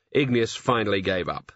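plain reading voice

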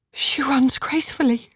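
breath held in disbelief; awed wonder. Acted. Mother marvels at cured daughter.

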